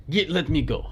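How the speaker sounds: put-on Spanish accent. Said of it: "Is this not a Spanish accent?".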